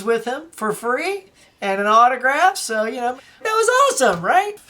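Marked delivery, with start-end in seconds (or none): 3.4-4.6 high, loud excited gush. Real, not acted. Fan telling viewers about meeting actor, excited. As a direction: burst with fan delight. Gushing over celebrity encounter.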